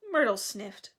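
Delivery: usual speaking voice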